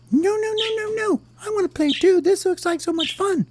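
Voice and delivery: very high, squeaky protest; eager little worm. Acted. Worm assures bird it is no trick, squeaky pleading. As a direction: insist excitedly in a tiny falsetto.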